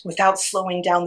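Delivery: ordinary delivery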